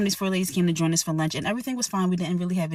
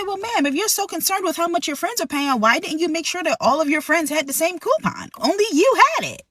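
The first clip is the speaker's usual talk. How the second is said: high, mocking comeback; sarcastic retort; 11 semitones higher, 3 dB louder than usual. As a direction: throw it back at her, sharp and high. Server snaps back at guest.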